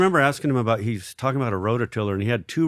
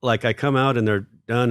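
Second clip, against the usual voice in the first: normal talking voice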